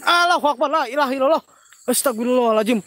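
loud, high cry of alarm; startled praying. Real, not acted. Host startled by an apparition, crying out prayers loudly. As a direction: burst out praying in fright.

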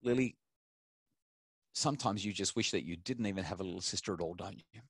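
father gently naming his daughter's wish, softly; soft empathetic parent. Shown as a demonstration. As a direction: coax a child's hidden wish, tenderly. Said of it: "So I might say".

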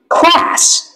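American "Class." with a flat front a as in cat; "Class." with the American vowel; American English. Shown as a demonstration. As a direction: say "Class." the American way, short bright a. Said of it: "Anh Mỹ".

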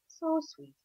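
high, soft and fond; tender. A narrator's reading. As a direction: coo it gently. Tender aside.

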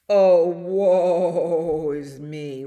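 loud, long-drawn quavering lament; whickering horse. A narrator's reading. Horse turned out of hen's bed, slow loud whicker. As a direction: moan it slowly like a horse. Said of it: "whickered horse".

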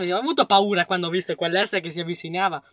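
ordinary delivery

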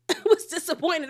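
repeated through a laugh. Real, not acted. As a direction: laugh while saying it again.

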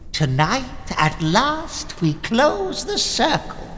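usual speaking voice

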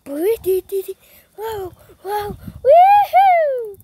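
high loud ride whoops; excited screaming. Real, not acted. On the spinning ride.

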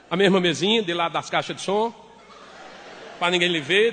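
ordinary talk

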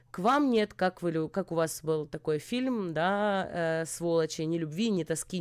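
ordinary talk, nothing marked

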